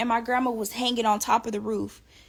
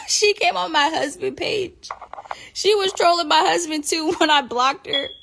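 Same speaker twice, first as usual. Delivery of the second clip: high, laughing, slowed telling; laughing while telling; 7 semitones higher, 5 dB louder than usual. Real, not acted. Laughing as she tells fans about grandma trolling her husband. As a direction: giggle through the words.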